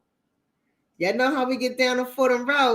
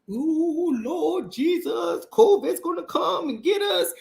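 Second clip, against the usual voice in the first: mock scared churchgoer; 5 semitones higher, slower than usual. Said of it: "people who are so like".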